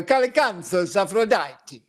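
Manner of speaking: drawn-out Italian pronunciation of the plant name; Italian accent. Shown as a demonstration. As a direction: relish it as an Italian would. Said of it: "instead I go".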